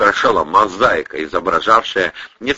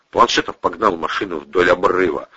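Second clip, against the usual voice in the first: ordinary delivery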